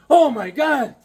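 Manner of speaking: high, loud shocked cry; shocked clerk. Retold or imitated. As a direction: mimic the clerk's loud disbelief. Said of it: "the guy's like".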